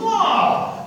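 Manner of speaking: very high, loud wow; awed angel. Acted. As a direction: squeal it like a thrilled angel. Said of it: "all these angels going".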